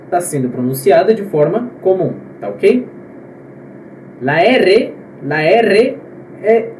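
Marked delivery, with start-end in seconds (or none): 4.2-6.0 "R" named "erre" with a rolled tongue; trilled R. Shown as a demonstration. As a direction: roll the rr in the Spanish name of "R". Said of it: "ele é vibrado".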